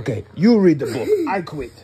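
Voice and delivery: loud, laughing give-up; mock quitting. Real, not acted. Dad quitting the bedtime story, loud and laughing. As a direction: throw the book at her with a laugh. Dad loudly quits reading.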